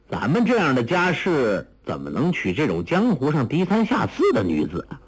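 haughty disdain. Acted. Son objecting to a lowly bride, haughty. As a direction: scoff at the very idea.

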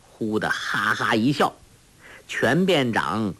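plain reading voice

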